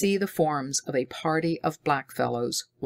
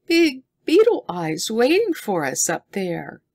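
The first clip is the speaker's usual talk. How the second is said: put-on high, louder frightened girl's voice; a scared girl staring at the sky; 7 semitones higher, 5 dB louder than usual. A narrator's reading. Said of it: "with fear".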